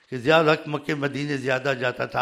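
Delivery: ordinary talk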